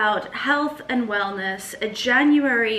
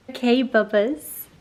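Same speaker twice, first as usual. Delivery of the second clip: bright, louder coo to the pups; 6 dB louder than usual. Real, not acted. To her dogs before the walk, louder doggy baby talk. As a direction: sing it out to your dogs.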